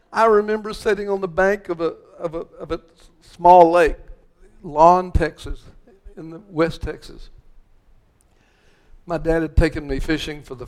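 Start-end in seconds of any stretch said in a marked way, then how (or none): none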